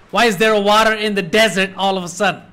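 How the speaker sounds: normal talking voice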